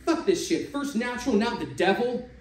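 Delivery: pissed off. Acted. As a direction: spit it out sore about losing. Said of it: "tone of his voice betraying how pissed off he was".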